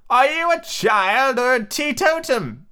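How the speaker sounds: high, loud, drawn-out bleaty voice; bleating sheep. A narrator's reading. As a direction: bleat the question slowly like a sheep. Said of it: "the sheep said".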